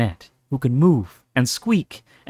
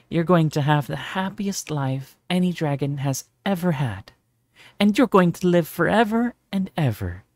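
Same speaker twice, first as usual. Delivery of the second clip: lightly voiced loving mother, raised; tender mother voice; 6 semitones higher than usual. A narrator's reading.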